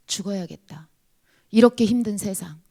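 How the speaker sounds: whispered resolve. Real, not acted.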